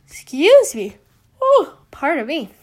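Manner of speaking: words stretched through a real yawn; apologizing mid-yawn, talking through it. Real, not acted. To her viewers while filming, pushing the words out through a yawn. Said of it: "I'm yawning".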